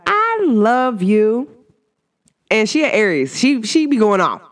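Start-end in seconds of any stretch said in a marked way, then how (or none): 0.1-1.5 high, slow, drawn-out I love you with a rise at the end; sweet I love you. Retold or imitated. Quoting her babe's loving high slow I love you. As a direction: stretch it out sweetly like a fond babe. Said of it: "She be like".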